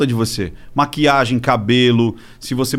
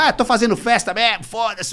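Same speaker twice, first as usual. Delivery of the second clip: put-on higher, carefree party girl voice; Pugliese partying; 6 semitones higher than usual. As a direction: toss it off like a careless party girl. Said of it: "Porque ela falou".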